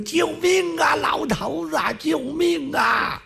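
high, wailing cry for help; old woman crying for help. Acted. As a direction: stretch out the last 救命啊 slowly. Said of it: "后来老太太喊上了". (老太太拖长声高喊救命)

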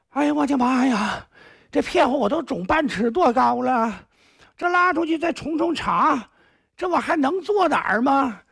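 high, whiny moaning of a beaten lad; whining plea. Acted. Beaten lad moaning to his uncle-master for mercy, high whiny plea. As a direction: moan and wheedle, pitifully high. 张方 begs after beating.

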